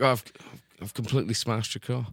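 put-on New Zealand accent; half-committed New Zealand impression; mock New Zealand accent. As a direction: break bad news in a joke New Zealand accent. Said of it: "worst news he could deliver".

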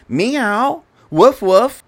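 high-pitched mock meow and woofs; mock animal sounds. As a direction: imitate a cat, then a barking critter. Acts child being cat and fox.

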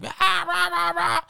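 high-pitched mock crowd yell; imitated yelling. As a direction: imitate rowdy friends yelling, without real volume. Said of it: "yelling with, like, her friends".

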